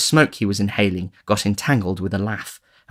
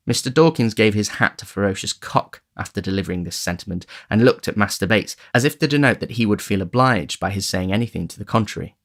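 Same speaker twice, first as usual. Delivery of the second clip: ordinary delivery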